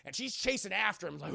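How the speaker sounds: ordinary talk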